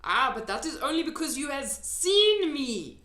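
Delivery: the giant protesting loudly to Sophie; giant crying out. A narrator's reading. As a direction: cry out in the giant's voice. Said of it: "cried the B F G".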